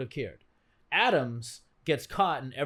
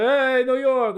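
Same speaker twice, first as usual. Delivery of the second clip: put-on loud, higher Jersey Shore style New York accent; fake New York accent; 8 semitones higher, 8 dB louder, much slower than usual. Mimicking Jersey Shore types to his friends, fake New York accent. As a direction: holler it like a hammed-up New Yorker. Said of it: "that all talk like this".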